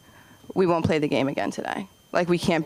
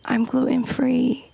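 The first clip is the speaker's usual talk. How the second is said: put-on whispered kid; 5 semitones higher, slower than usual. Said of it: "And she's like".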